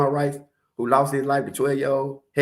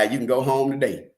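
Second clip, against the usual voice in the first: usual speaking voice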